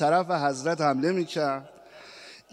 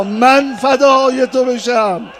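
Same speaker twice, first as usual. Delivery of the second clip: من فدای تو بشم drawn out loud and slow, falling at the end; wailing devotion; 7 semitones higher, 12 dB louder, slower than usual. Acted. Mother answers her lord, wailing.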